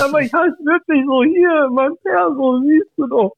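high-pitched, drawn-out comic pleading; mock protesting Günther. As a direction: plead in a high, hammed-up voice to be believed. Imagined Günther protesting his name.

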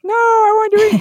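put-on high, loud, protesting child; whiny kid voice. Parent imitating her kids, high loud whiny child. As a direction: mimic the kids pushing back on Chinese. Kids refuse Chinese movie track.

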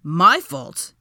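loud, affronted question; indignant. A narrator's reading. Draco blamed by Hermione, indignant. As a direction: throw it back, offended and loud. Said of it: "he said indignantly".

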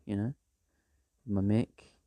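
usual speaking voice

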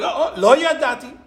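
high, singsong feigned innocence; mock Bilam's lame excuse; Bilam's slogan, whined high; mock innocent plea.

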